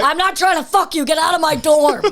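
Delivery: high, loud, hammed-up rejection; mock angry shout. Co-host plays Doom throwing his rival out, mock shout. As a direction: yell it like an outraged roommate. Acting Doom's rebuff, shouted.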